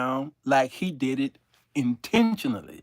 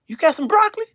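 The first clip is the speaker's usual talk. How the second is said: high, loud eager question; eager high asking; 7 dB louder, much faster than usual. Retold or imitated. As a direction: ask greedily. Voicing greedy passenger.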